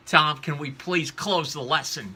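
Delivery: loud, high imitation of impatient youths; mock youth complaint. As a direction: plead like bored kids wanting it over. Youth complaining about a long lesson.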